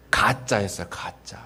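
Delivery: hushed reveal, almost unvoiced; whispered. Real, not acted. Pastor whispers the twist of the story to the congregation, hushed. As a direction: let the secret slip in a whisper.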